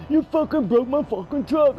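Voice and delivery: high, loud furious yell; angry driver. Retold or imitated. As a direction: bellow it at him. Angry driver voiced in story.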